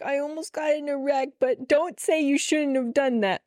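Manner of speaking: high, whiny mock-crying plea; tearful. Daughter telling mom about the near wreck, tearful. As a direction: imitate yourself pleading through tears. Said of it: "I'm, like, crying".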